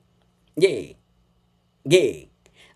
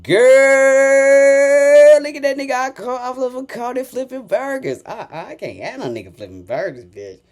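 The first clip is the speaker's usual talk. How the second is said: mocking gossip; 8 semitones higher, 4 dB louder than usual. Imitating critics gossiping about a burger job, mocking. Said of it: "That's what you sound like".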